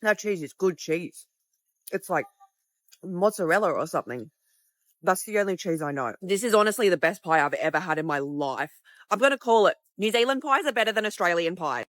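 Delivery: usual speaking voice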